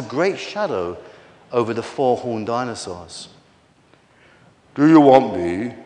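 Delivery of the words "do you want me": low, resonant giant king's voice; deep, soothing storybook king. A narrator's reading. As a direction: speak deep and loud as a huge, gentle king. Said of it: "he said in a deep, soothing voice".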